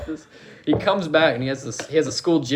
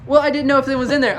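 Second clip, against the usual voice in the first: high, fast defensive blurt; retold excuse; 9 semitones higher, 5 dB louder, faster than usual. Scolded kid to the principal, high and hurried.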